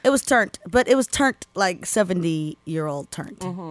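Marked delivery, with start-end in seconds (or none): 1.6-3.4 slow emphasis. Shown as a demonstration. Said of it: "explaining the slang".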